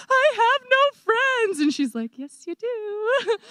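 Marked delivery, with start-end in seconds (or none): 0.1-1.6 high, drawn-out comic wail; mock crying. Crying to a friend over lost friends, mock crying. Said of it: "I was crying like". 2.2-3.4 high, drawn-out comforting coo; mock consoling friend. Friend answering the crying speaker, mock consoling.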